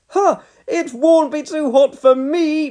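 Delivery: high, slow cocky boast; boy boasting about chili sauce. A narrator's reading. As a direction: show off to the grown-ups. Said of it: "boasted Norman".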